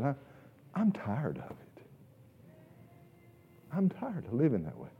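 quietly worn-out plea; weary confession. Acted. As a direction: let the words sag with weariness. Psalmist voiced wearily.

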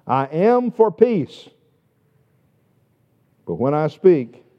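loud, ringing pulpit delivery; preacher booming the psalm; full-force preaching. Acted. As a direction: proclaim it to the whole congregation.

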